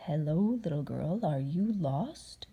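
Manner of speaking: low, slow, sly wolf. Acted. Wolf creeping up on the girl, low sly voice. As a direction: lure her in, low and slow. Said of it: "What was that?".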